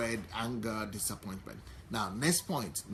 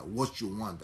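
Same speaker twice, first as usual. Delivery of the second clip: ordinary delivery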